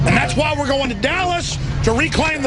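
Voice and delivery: furious shouted rant. Real, not acted. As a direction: rail at them, pitch climbing. Ranting against control freaks.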